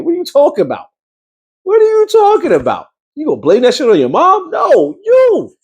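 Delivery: high mock-outraged squeal; outraged disbelief. Real, not acted. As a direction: squeal in disbelief at her excuse. Mocking outrage at character.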